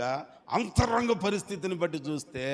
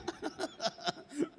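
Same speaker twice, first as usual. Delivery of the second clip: high laugh; 14 semitones higher, 5 dB quieter than usual. Real, not acted. Laughing about teeth.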